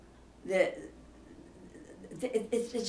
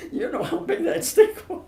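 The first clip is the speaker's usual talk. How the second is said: laughing; 13 semitones higher, 7 dB louder than usual. Real, not acted. Laughing while telling the story.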